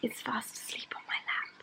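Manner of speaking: hushed real whisper, barely voiced; very soft, breath-only murmur beside someone sleeping. Real, not acted. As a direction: whisper so the sleeper on your lap stays asleep. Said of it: "I'm whispering because someone is fast asleep".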